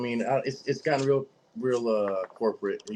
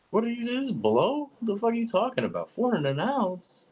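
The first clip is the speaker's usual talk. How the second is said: much higher, outraged disbelief; incredulous outburst; 4 dB louder than usual. Retold or imitated. Retelling his reaction to a four hundred ounce price, high and outraged. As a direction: blurt it like the price is a joke.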